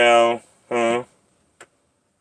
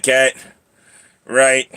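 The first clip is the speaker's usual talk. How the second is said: ordinary talk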